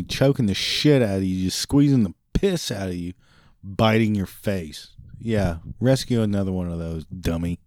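normal talking voice

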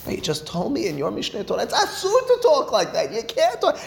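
very high, squeaky-pitched objection; incredulous protest. Acted. Teacher playing a baffled challenger of Harambam, incredulous protest.

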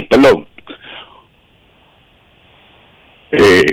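short shaky breaths; struggling for breath, emotional; hyperventilating. Real, not acted. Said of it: "Respira, hermano". (respirando agitado, al borde del llanto)

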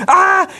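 high, frantic "ah" cry; exasperated hair-pulling yelp; panicked yell. Acted. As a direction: cry out in comic despair. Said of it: "he's pulling his hair out".

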